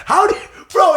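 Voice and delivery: high, loud incredulous yell; incredulous shout. Real, not acted. Mocking the French troops, an incredulous shout. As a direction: shout it in disbelief.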